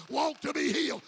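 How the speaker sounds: loud high Walk to be healed; shouted refrain. Shown as a demonstration. Preacher to church, belting Walk to be healed. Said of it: "there's a song in there somewhere".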